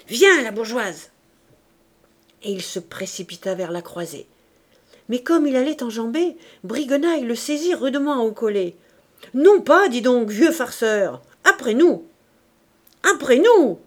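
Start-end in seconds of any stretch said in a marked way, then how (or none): 0.1-1.1 slow, loud call across the room; shouting to wife. A narrator's reading. Thénardier calling his wife to the window, slow loud shout. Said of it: "Thénardier cria". 9.3-12.1 rough angry bandit. A narrator's reading. Bandit grabs Thénardier by collar. 13.1-13.8 loud rough demand; single bandit's shout. A narrator's reading. Briguenaille holding Thénardier back, loud rough demand.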